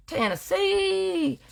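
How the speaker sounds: high, slow, drawn-out Tennessee!; announcing the city, ringing high and slow; proclaiming Tennessee. Pretend. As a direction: stretch Tennessee out like a showman. Drawn out sung-like place name.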